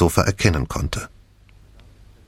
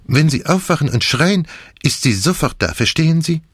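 put-on higher-pitched character voice, accented and earnest; Poirot reassuring; 7 semitones higher than usual. A narrator's reading. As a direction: reassure a frightened patient insistently.

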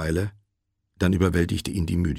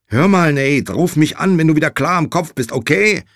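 loud, high, insistent phone voice; raised, pressing instructions; urgent instructing; 10 semitones higher, 8 dB louder than usual. A narrator's reading. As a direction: get through to a groggy friend. Josh instructs drugged friend.

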